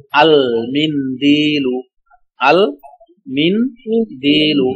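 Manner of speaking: আল মিনদিলু very slowly, L in আল fully sounded; teacher modelling আল মিনদিলু slowly for students; clear lam demo. Shown as a demonstration. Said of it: "লামের উচ্চারণ স্পষ্ট।".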